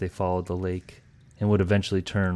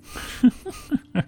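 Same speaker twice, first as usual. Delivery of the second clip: snooty cat chuckle. Acted. Cat laughs after sneer.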